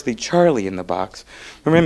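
ordinary talk

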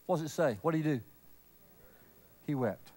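dropped to a low hush; hushed, tender reveal. Real, not acted. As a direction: let it fall almost silent. Softly announcing Jesus wept.

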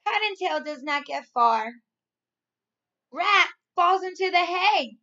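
put-on loud, lively storybook reading for young kids; reading to children. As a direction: read it out big and bright, like to a class of little ones. Teacher reads storybook to class.

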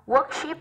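"Worship" with stress on "Wor"; first-syllable stress. Shown as a demonstration. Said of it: "rơi vào âm một".

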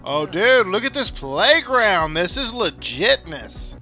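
usual speaking voice

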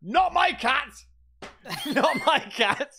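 high, loud cry of denial, then laughing; hammed-up disbelief breaking into laughter; mock outraged cat owner. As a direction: play a cat owner refusing to believe it. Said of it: "cat owners are going".

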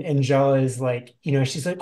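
normal talking voice

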